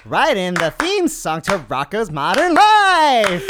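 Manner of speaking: loud, high, drawn-out singer impression. Player improvising an answer in the game, hollered impression. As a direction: holler it slowly in rhythm. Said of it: "an impersonation of [name]".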